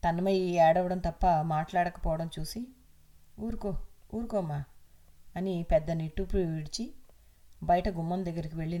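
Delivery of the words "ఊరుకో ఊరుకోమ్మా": soft, hushing comfort; consoling. Acted. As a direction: soothe her gently, like an old grandfather. Grandpa consoling crying girl.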